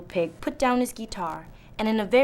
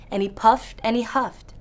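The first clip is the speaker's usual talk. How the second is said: usual speaking voice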